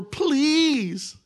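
high, drawn-out begging please; a lightly voiced Abishai pleading. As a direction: beg like an eager sidekick. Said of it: "Then Abishai said to David".

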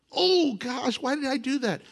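high, mock-anguished wail; comic regret. [name] regretting, hammed-up high lament.

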